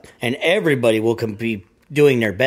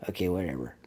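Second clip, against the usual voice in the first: much lower and much softer, uninterested; bored brush-off; 7 semitones lower, 14 dB quieter, faster than usual. Acted. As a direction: mumble it low, like you couldn't care less. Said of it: "And I'm like".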